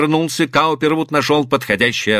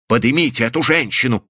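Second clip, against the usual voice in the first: ordinary delivery, nothing marked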